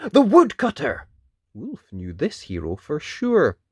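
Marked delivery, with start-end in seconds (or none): 0.1-1.1 excited exclaim. A narrator's reading. Narrator exclaims character's arrival.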